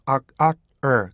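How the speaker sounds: "악, 악, er" as three clear beats. Shown as a demonstration. Teacher splitting "are" into three beats. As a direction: break "are" into three parts. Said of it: "그래서 어떻게 돼요".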